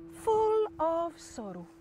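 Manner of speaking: soft, very drawn-out lament; mock sorrow. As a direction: stretch each word mournfully. Husband describes the others' sorrow.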